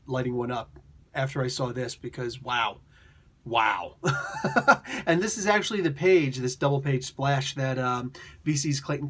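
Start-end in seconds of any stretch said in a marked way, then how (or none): 4.0-5.0 burst of high laughter. Real, not acted. Reacting to the comic page.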